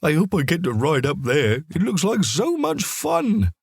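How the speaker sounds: a dog dreaming of riding up top; excited dog thought. Acted. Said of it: "he thought".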